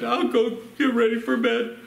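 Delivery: high, put-on crying little-girl voice; imitated sniveling kid; mock tearful child. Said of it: "she started crying all right away".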